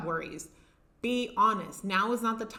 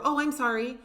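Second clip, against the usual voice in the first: higher, louder automatic mock apology; reflexive apology; 5 semitones higher, 5 dB louder than usual. Imitating someone apologizing to a door they bumped, reflexive and higher. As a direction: blurt a mock sorry on reflex. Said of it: "That's how reflexive that has become".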